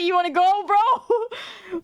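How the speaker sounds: high, cocky dare close to laughter; mock challenge. Challenging a guy to basketball, mock-combative and giggly.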